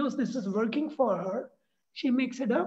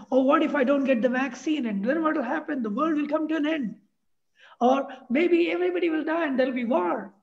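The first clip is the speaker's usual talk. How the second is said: higher, louder voice spiralling into worst-case worry; anxious what-if voice; 5 semitones higher, 4 dB louder than usual. Pretend. Speaker acting out a person catastrophising about the vaccine, anxious and rising. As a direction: play the panicky overthinker, voice climbing. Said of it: "we can say".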